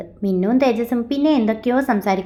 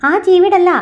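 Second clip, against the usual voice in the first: loud, high-pitched protest; exasperated correction; 8 semitones higher, 7 dB louder than usual. A narrator's reading. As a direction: burst out to put them right. Exasperated correction of misheard name. (ഉറക്കെ, ക്ഷമകെട്ട് തിരുത്തുന്ന മട്ടിൽ)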